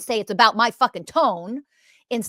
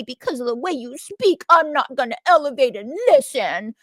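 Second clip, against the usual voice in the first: higher, put-on whining complainer voice; mock a whiny listener, pitched up; whiny imitation; 5 semitones higher, 3 dB louder than usual. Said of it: "I don't go whining and crying and say".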